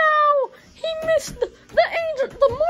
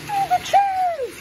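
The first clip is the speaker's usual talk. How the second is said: wrestling commentator; 8 semitones higher, slower than usual. Acted. Calls the action like commentator.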